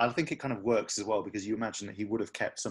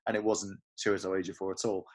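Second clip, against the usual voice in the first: ordinary talk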